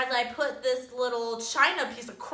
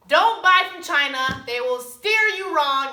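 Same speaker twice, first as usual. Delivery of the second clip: loud, raised-pitch emphatic warning; 4 semitones higher, 7 dB louder than usual. Real, not acted. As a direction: drive the warning home to the audience. Final warning to all viewers.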